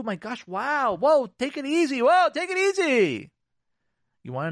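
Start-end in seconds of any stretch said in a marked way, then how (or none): 0.0-2.0 hammed-up placating spouse; mock whoa, calm down. Imitating a spouse telling a hurt partner to calm down, mock placating. 2.0-3.3 much higher, louder exaggerated brush-off; hammed-up whoa, calm down. Imitating a spouse telling a hurt partner to calm down, hammed-up high and loud.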